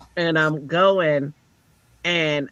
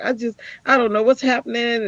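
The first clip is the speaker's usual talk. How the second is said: mock distress; 8 semitones higher than usual. Retells own distressed rant to counselor.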